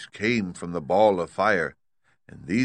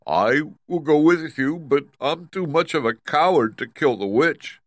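high, loud lion voice; loyal Cowardly Lion; 8 semitones higher, 6 dB louder than usual. Acted. Lion offering to join Dorothy, loud and high. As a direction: declare it loudly, pitched up as a lion.

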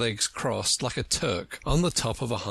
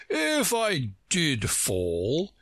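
very slow, higher-pitched pompous voice; grand, drawn-out announcement; Humpty Dumpty, solemn and grand; 6 semitones higher, much slower than usual. Acted. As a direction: stretch it out with great self-importance.

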